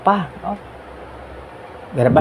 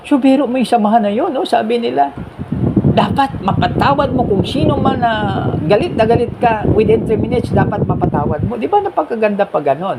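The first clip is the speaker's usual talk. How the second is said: amazed; 8 semitones higher than usual. Real, not acted. Marvelling at the group's rule.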